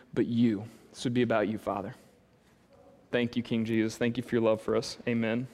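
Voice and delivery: low, hushed prayer voice. Acted. Pastor praying over the congregation, reverent prayer voice. Opening prayer before sermon.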